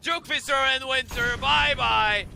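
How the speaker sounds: loud, high hype call; excited shout. Real, not acted. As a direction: yell it out as the kill lands. Caster hyped on a kill.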